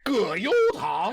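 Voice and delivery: very high, mock wailing delivery; emotional take. Shown as a demonstration. Host records takes in different emotions, high mock wail. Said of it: "do it in different emotions".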